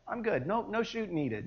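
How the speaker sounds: too-relaxed, nonchalant refusal; breezy dismissal. Acted. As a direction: wave it off airily, tailing into a soft aside. Mimics a casual skydiver declining.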